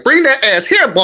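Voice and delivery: high-pitched catchphrase impression; D C Young Fly. Quoting a comedian's bit the speaker hates, imitating. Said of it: "D C Young Fly? He be like".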